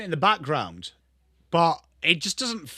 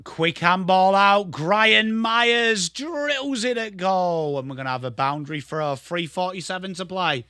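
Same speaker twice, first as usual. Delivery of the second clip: loud drawn-out call, then quicker wind-down; live football play-by-play; 3 semitones higher, 3 dB louder than usual. Pretend. Streamer calls a shot at goal, excited commentary. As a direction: hold the player's name and the shot long, then settle.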